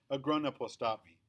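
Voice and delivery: usual speaking voice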